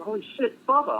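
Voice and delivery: high-pitched shocked cry of recognition; old friend suddenly recognizing him, calls out in surprise; surprised recognition. Retold or imitated. PA realizing who the patient is, surprised high exclamation.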